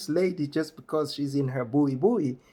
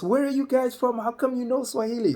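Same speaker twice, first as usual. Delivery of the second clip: high, animated bystander questions; eager curiosity; 9 semitones higher than usual. Retold or imitated. Everyone asking where they are from, eager and curious. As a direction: pepper them with excited questions in a raised pitch.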